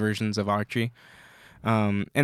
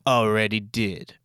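gruff Wolverine; 4 semitones lower, 4 dB quieter, slower than usual. Retold or imitated. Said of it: "And then Wolverine goes".

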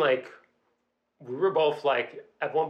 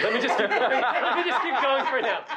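higher, giggling through the words; laughing; 9 semitones higher than usual. Real, not acted. Storyteller embarrassed by her graphic tale, laughing.

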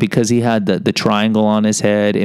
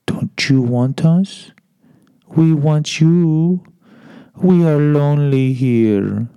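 high, slow, coaxing voice of the snacks; tempting food voices; 5 semitones higher, much slower than usual. Pretend. Snacks calling out to him, slow and coaxing. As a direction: lure him in, drawn out and high. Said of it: "They would say stuff like".

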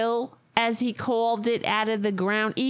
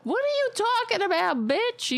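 high, fast challenge; indignant, pitched way up and rushed; indignant retort; 12 semitones higher than usual. Acted. Arguing back at the woman in the clip, indignant.

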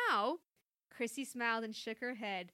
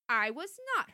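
loud, higher-pitched denial; indignant; 5 semitones higher, 7 dB louder than usual. A narrator's reading. Mom defends herself to daughter, indignant. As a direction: deny it, affronted. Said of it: "her mother replied indignantly".

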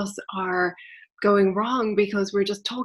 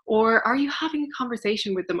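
ordinary delivery

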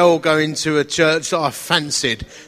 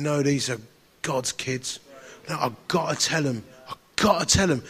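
hushed; 6 semitones lower, 8 dB quieter than usual. Retold or imitated. Tearful realisation retold.

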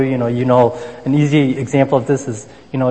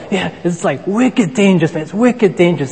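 put-on higher-pitched, half-hearted Boston accent; badly faked Boston accent; 5 semitones higher than usual. Preacher imitating his neighbor's warning about icicles, badly faked Boston accent. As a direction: attempt a Boston neighbor's voice and miss. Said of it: "I can't even fake a Boston accent".

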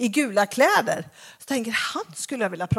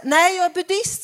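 imitating buddhist; 7 semitones higher, 5 dB louder than usual. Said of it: "säger han".

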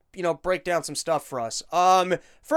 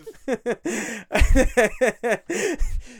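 real burst of laughter, high; real laughter at the silly line; 4 semitones higher than usual. Real, not acted. Laughing at the Jim joke.

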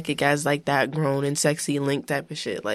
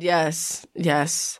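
ordinary talk

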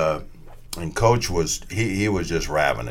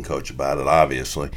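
normal talking voice

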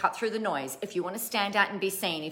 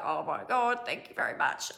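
awkward whisper; 3 semitones lower, 6 dB quieter than usual. Retold or imitated. Retold awkward thanks to fan.

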